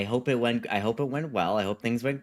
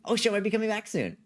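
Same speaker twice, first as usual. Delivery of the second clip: much higher, startled exclamation; retold surprise; 10 semitones higher than usual. Streamer retelling seeing the friend's post, a much higher surprised cry. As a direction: replay the moment of discovery. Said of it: "And I was like".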